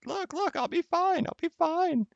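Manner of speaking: very high falsetto imitation of the wife; mock wife voice. Husband mimicking wife's dismissive reassurance in high falsetto. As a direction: squeak out her breezy reassurance in falsetto. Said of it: "I'm like".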